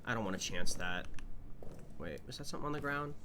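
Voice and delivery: normal talking voice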